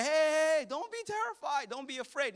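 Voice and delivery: high-pitched call, starting loud then easing; urgent calling out. Acted. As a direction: call out to calm a panicking crowd. Moses calling out to panicking people.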